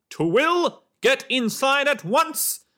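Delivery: high, loud, slow shout; father's angry call. A narrator's reading. Father shouting down the lane for his daughter, angry shout. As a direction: yell her back to the house. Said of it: "voice of Twill's father echoed".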